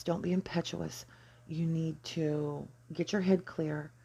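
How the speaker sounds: ordinary talk, nothing marked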